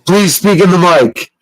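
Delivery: loud, insistent demand into the mic; loud mock command to speak into the mic; mock stern order. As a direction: boss someone to speak into the mic. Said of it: "Speaking to the microphone".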